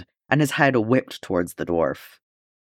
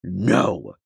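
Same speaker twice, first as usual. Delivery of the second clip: ordinary delivery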